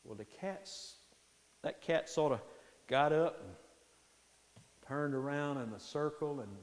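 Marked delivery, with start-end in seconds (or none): none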